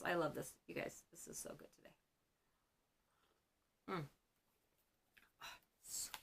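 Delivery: soft, half-whispered aside while savoring; quiet aside. Real, not acted. Vlogger to viewers while tasting something, hushed. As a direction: murmur it to yourself over a treat. Savoring a treat mid-video.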